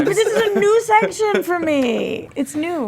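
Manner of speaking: whiny voice. Real, not acted. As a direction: whine the words out slowly. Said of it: "I got that whiny voice going on".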